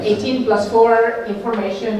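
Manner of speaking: usual speaking voice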